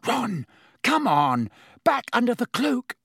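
high, breathless urgent calls; out of breath from the sprint; panting. Acted. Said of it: "Hermione panted".

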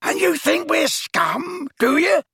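very high, drawn-out outraged screech; scream. A narrator's reading. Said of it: "screamed Gaunt".

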